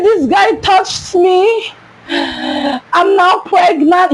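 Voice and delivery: high, drawn-out mock wail; retold crying. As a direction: bawl it slowly like an upset child. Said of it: "But I was crying".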